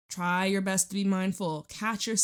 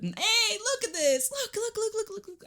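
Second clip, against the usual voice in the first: much higher, eager look look look; thrilled, pointing out the card; excited; 14 semitones higher than usual. Real, not acted.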